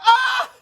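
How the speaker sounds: high, loud burst of a holler; loud laughing holler. Retold or imitated. Narrator recalls hollering in the parlor, loud shriek. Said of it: "hollered in that fucking massage parlor".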